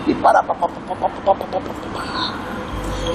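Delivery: whisper-rattle a machine-gun volley; fading mock gunfire, half whispered; imitated gunfire.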